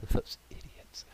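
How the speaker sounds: hushed, slow gloating whisper; slow smug whisper; whispered aside. Acted. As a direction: whisper it like a sly goblin sneering at his dupes. Gleeful aside about party members.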